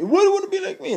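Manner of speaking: very high, funny comedian voice; exaggerated high-pitched comic impression; Tracy Morgan. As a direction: squeal it as a celebrity impersonation. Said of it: "I'm not gonna do his voice".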